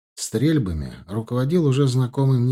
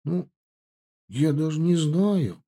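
unsure; 6 semitones higher than usual. Acted. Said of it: "ответил Федя неуверенно".

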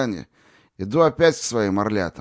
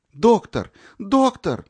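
much higher and louder cry, rising on the repeat; desperate call; 13 semitones higher, 5 dB louder, much slower than usual. A narrator's reading. Natasha calling the doctor to the dying boy, desperate shout. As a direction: shout for help across the ward. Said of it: "закричала Наташа".

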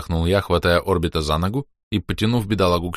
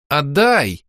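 louder stubborn отдай; firm repeat; 7 semitones higher, 4 dB louder than usual. A narrator's reading. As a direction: insist on отдай, pressing. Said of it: "повторила юная богиня".

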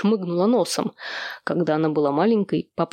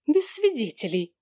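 higher-pitched offended echo; indignant echo; 6 semitones higher than usual. A narrator's reading. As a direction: throw the words back, affronted. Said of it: "возмутилась Джо".

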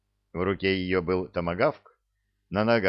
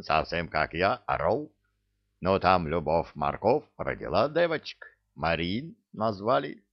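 put-on accented, self-admiring raconteur; Givi, boastful storyteller. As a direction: tell the romance as a comic Givi. (Гиви, хвастливый рассказчик с акцентом)

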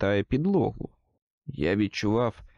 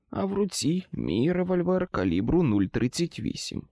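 plain reading voice

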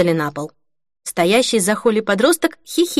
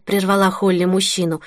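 ordinary delivery, nothing marked

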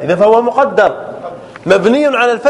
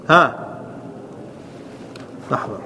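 normal talking voice

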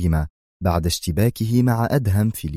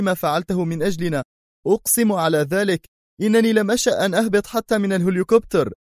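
much higher, panicked pilot begging; terrified pleading; 12 semitones higher than usual. A narrator's reading. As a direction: plead fast and high for your life, faster at the end. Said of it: "قائلا في ذعر".

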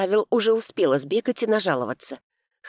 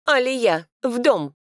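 higher, snapped order like to a dog; curt command; 8 semitones higher, 4 dB louder, slower than usual. A narrator's reading. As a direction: toss the order out curtly. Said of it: "Как собаке бросила".

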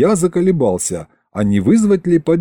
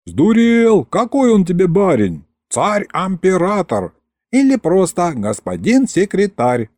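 high, hollered correction; indignant shouting; 8 semitones higher than usual. A narrator's reading. Townsman corrects another on the ruler's title, shouting indignantly. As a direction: shout him down from the crowd.

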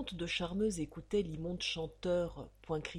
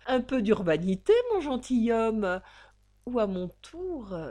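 louder, sneering warning; loud mocking threat; 4 semitones higher, 8 dB louder than usual. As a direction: raise your voice to threaten him. Blackmailer threatens the count.

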